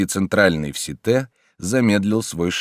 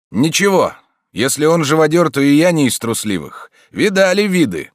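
higher and louder, tough street swagger; thieves' slang; 9 semitones higher, 6 dB louder than usual. A narrator's reading. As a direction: talk like a seasoned crook. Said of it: "отвечал на чистом воровском наречии незнакомец". (дерзкий воровской говор)

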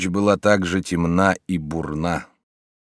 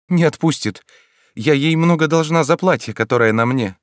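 put-on much higher, light girlish voice; young girl's voice; 8 semitones higher, 4 dB louder than usual. A narrator's reading. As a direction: voice a poor young girl, pitched up. Girl voiced by narrator. (юная девушка, высоким голосом)

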